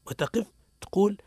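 ordinary talk

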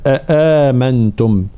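أآمنتم with both hamzas fully said; أآمنتم بتحقيق الهمزتين; two full hamzas. Shown as a demonstration. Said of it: "أصلها".

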